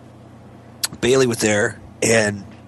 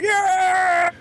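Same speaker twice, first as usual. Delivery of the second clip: very high, drawn-out cheer; crowd screaming. Retold or imitated. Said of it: "We're all screaming".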